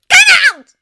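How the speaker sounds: high, very loud shout; furious full-volume command; snapped order. A narrator's reading. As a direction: yell it to throw him out. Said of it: "snapped Elphaba".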